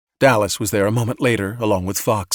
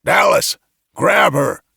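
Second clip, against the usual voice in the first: loud shouted order; officer shouting an order; 4 semitones higher, 5 dB louder, slower than usual. A narrator's reading. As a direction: bark the command down the stairs. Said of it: "Fox shouted from above".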